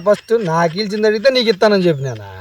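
usual speaking voice

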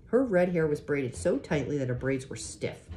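ordinary delivery, nothing marked

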